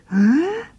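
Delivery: sharp high gasp of fright; child gasps at a noise in the dark; frightened gasp. Acted. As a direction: suck in air as if something moved.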